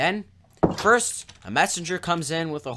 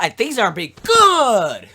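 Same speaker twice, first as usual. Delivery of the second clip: sarcastic; 6 semitones higher, 9 dB louder than usual. Real, not acted. Narrator commenting on the murder plot, sarcastic. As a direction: exaggerate it with heavy sarcasm, louder and higher. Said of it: "I'm just being sarcastic there".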